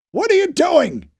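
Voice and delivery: a high, loud shout across the battle; yell. A narrator's reading. A soldier yells at a man standing in the street, loud shout. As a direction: yell it to someone standing in the line of fire. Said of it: "someone yelled".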